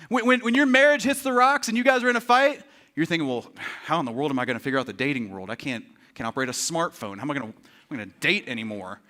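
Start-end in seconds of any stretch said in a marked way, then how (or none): 3.3-9.0 low, soft, glum muttering; hammed-up hopelessness, sunk low and quiet; mock despairing. Newly single person fretting over dating, mock despairing.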